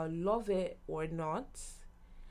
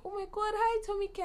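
much higher, thrilled greeting; starstruck squeal. Retold or imitated. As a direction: squeal hello at the star.

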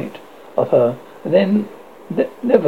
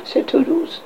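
ordinary delivery, nothing marked

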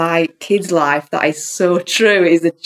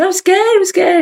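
high, loud frightened cry; high scared wail; freaked-out plea; 14 semitones higher, 4 dB louder than usual. Retold or imitated. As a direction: plead like a scared thirteen-year-old. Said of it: "Freaking out".

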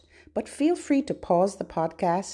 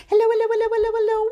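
high, loud rapid-fire hellos; quick, high-pitched chatter; as fast as possible; 12 semitones higher, 4 dB louder, much faster than usual. Shown as a demonstration. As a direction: rattle them off without a gap. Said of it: "as fast as we can".

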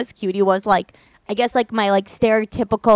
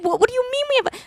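confused; 12 semitones higher, faster than usual. Retold or imitated. Her confused reply retold.

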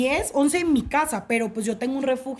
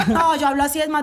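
ordinary talk, nothing marked

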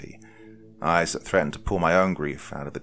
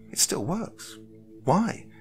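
higher-pitched, questioning woman; puzzled woman; 6 semitones higher than usual. A narrator's reading. Woman puzzling over the gas in the kitchen, bewildered. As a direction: reason it out with rising disbelief.

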